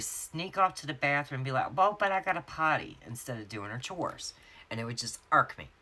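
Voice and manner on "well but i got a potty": put-on high-pitched little kid excuse; little daughter. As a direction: imitate a small child's higher, protesting voice. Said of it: "when my daughter was little, she would".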